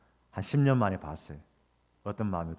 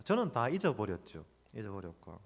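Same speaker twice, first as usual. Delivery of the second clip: ordinary delivery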